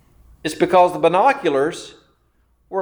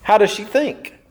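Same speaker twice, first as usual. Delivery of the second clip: normal talking voice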